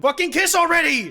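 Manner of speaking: high, loud yell at the screen; impatient shout. Real, not acted. Streamer yelling at the couple to kiss, loud. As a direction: demand they kiss.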